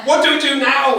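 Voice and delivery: loud, high panicked cry. A narrator's reading. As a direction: blurt it out in a panic. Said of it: "was generally panicked by panicking generals".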